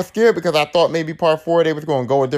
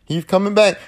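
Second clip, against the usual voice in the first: normal talking voice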